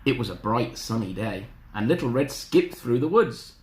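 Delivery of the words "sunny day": plain reading voice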